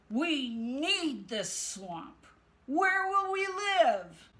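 slow, rising plea; pleading. Acted. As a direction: plead for your home slowly. Creatures pleading.

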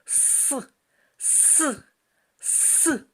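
s hissed a bit stronger. Shown as a demonstration. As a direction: hiss the s out firmly. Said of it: "А тепер голосно".

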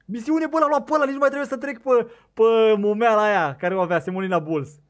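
high, loud excited rush; triumphant shout. Real, not acted. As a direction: yell the good news, overjoyed. Mission fish caught.

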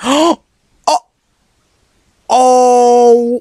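high loud gasping oh; shocked gasp. Real, not acted. Sudden shock in game.

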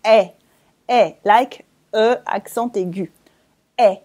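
eh said short and open, like é; eh, like E accent aigu; French e sound. Shown as a demonstration. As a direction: say the sound eh clearly for the learner. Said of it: "and you say it eh".

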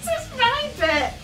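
high and loud, teasing claim; playful protest. Real, not acted. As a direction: insist it's your part, laughing. Arguing over who reads.

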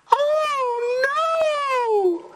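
very high squeaky fly voice; tiny high-pitched crying fly. Acted. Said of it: "said the spider to the fly".

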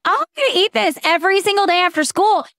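put-on high, fast, eager kid voice; excited kid. Mom imitating her kids gushing over a snack, high and fast. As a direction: gush like a kid who loves the sample. Kids quoted at sample day.

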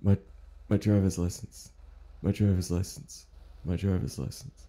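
puzzled mumble. Acted. Confused old man repeats puzzled.

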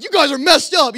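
loud, high-pitched indignation; mock an exasperated watchman shouting; retold outraged shout. Night watchman recoils from naked camper, imitated outraged shout.

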